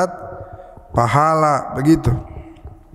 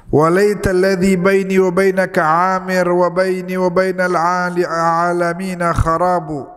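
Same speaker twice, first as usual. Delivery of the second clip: higher, sustained recitation voice; Arabic verse recitation; 5 semitones higher than usual. Acted.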